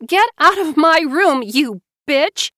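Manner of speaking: high loud furious yell. A narrator's reading. Loud high furious yell. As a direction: Joanna screaming her sister out. Joanna screaming at sister.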